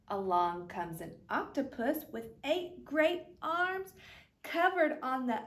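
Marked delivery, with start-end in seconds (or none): none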